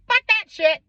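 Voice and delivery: loud, high imitation of outrage; retold emphatic refusal, pitch leaping; indignant dad. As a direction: burst out like a dad having none of it.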